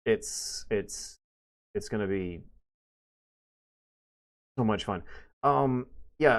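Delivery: ordinary talk, nothing marked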